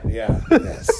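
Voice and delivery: usual speaking voice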